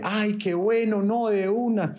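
high, drawn-out mock female delight; imitated delighted woman. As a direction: gush in a put-on high voice. Man voices woman's delighted acceptance. (imitando a la chica encantada, voz aguda)

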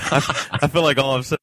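high-pitched laughter spilling into words; helplessly amused, talk through real laughter; cracking up. Real, not acted. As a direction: laugh your way into the line. Cracking up over repeating nice.

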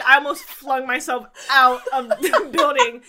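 high, loud, slowed words breaking into laughter; amused outrage with a laugh inside; laughing exaggeration. Real, not acted. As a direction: punch it out while cracking up. Laughing at cousin saying vintage.

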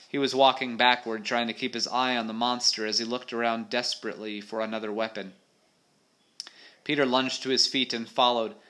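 animated adventure read-aloud; story narration. A narrator's reading. Reader telling of a monster fight, story narration.

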